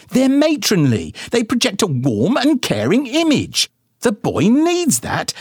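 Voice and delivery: high-pitched, huffy mother voice; indignant mama. A narrator's reading. Mama bickering with daddy about his bald spot, high and huffy. Mama defends her look.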